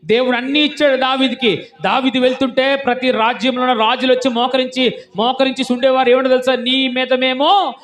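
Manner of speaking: loud, high-pitched denunciation from the pulpit; full-force preaching. Real, not acted. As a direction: thunder it out to the congregation.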